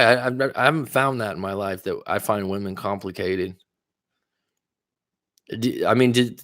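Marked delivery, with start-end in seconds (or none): 5.6-6.4 tripping over the first word; stuck on a repeated d sound; real stuttering. Real, not acted. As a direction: stammer rapidly on the opening consonant. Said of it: "as I'm stuttering".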